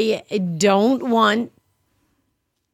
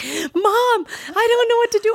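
panicked daughter; 13 semitones higher than usual. Retold or imitated. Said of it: "She's like".

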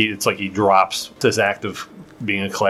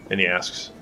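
ordinary talk

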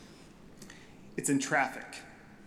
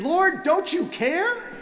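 exasperated cry; 11 semitones higher, 3 dB louder, slower than usual. Retold or imitated. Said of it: "I want to scream".

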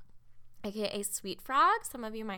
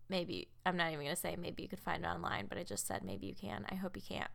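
ordinary delivery

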